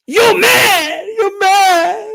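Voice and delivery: loud, high-pitched fake bawling; mock crying. Streamer imitates chat trolls crying at him, mock bawling. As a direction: wail it like a crybaby troll, hammed up. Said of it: "these dudes are crying, tell me I'm mad".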